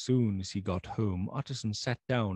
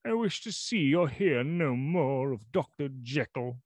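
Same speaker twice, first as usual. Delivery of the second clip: raised, wavering cry of refusal; loud unsteady voice; 6 semitones higher than usual. Acted. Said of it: "in a loud, unsteady voice".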